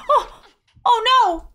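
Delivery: sudden high, loud oh no; startled yelp. Real, not acted. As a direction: jump at the shock. Startled by countdown.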